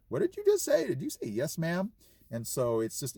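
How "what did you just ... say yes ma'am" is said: high-pitched incredulous questioning; imitated family surprise. Family catching his new "ma'am" habit, imitated high-pitched surprise. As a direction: mimic relatives doing a double take, voice jumping up. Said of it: "they're like".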